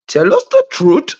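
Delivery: put-on joking Indian-accented demand; mock Indian accent. Joining in to press Orly, mock Indian accent. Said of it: "Everybody doing Indian voices".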